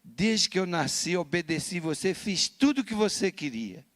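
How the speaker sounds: a hint of a teenager standing her ground; daughter voiced. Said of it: "Ela falou". (imitando a filha decidida, de leve)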